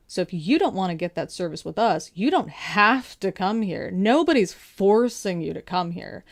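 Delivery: mock-polite, leaning hard on key words; snotty. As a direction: imitate a snotty official laying down the rules. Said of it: "That kind of tone".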